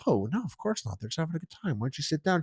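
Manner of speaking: calm her down quietly; soft reassuring guy. Retold or imitated.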